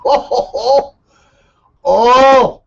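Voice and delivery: loud, high "oh" after a burst of laughter; laughing whoops. Pretend. Host reacts to naming his favorite tournament, high laughing whoops. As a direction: whoop it up with rising, drawn-out ohs.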